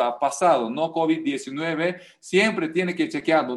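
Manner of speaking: ordinary talk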